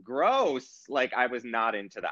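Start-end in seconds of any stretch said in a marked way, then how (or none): 0.0-0.8 higher, grossed-out groan; annoyed ugh. Retold or imitated. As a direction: say it like it's gross. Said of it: "I'm like".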